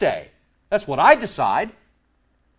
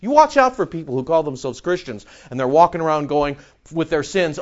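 usual speaking voice

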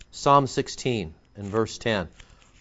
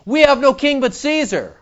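higher, mocking repeat of the priests; scornful quote; 12 semitones higher, 5 dB louder than usual. Preacher mocking the chief priests' answer with scorn. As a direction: throw their words back with scorn.

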